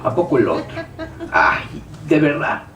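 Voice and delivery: low, muttered mock disbelief; imitated doubter. Preacher voices a listener doubting the promise, sceptical. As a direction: think aloud sceptically, pitched well down. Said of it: "usted empieza a pensar y dice".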